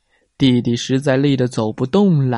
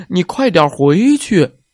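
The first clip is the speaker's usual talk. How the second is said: louder scolding call; annoyed call; 5 semitones higher, 5 dB louder than usual. A narrator's reading. Big brother yelling at little brother to go home. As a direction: send him home sharply. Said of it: "冲着弟弟叫道".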